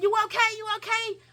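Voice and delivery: very high mock-concerned question; high-pitched imitated check-in; imitated onlooker. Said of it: "She looked over and said".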